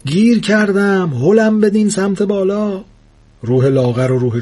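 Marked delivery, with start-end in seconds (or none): 0.0-2.8 stuck ghost shouting. Acted. Stuck in the ceiling, the blue ghost yells for a push. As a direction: yell up to be pushed free. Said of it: "روح آبی پوش داد زد".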